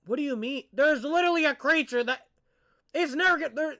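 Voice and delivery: worked up at the screen; real frustration spilling out at the video; getting mad. Real, not acted. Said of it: "Why am I getting mad?".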